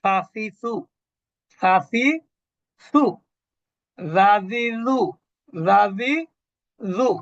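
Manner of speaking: "সাসিসু" hissed s, "রাজিজু" buzzed, tongue at upper teeth tips; tongue-tip letter drill. Shown as a demonstration. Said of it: "উপরের দাঁতের আগা". ("সাসিসু, রাজিজু" জিহ্বার আগা উপরের দাঁতের আগায় রেখে টেনে উচ্চারণ)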